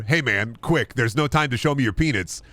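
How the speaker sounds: ordinary delivery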